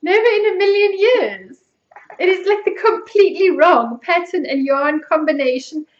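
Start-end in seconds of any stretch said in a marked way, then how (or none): 0.0-1.6 high-pitched, insistent protest; emphatic refusal. Real, not acted. Refusing to wear the knitted garment.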